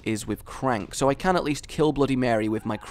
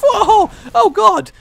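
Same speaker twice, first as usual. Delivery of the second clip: high, loud startled yelp; shocked cry; 12 dB louder, slower than usual. Real, not acted. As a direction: caught off guard, cry out. Sudden shock at game event.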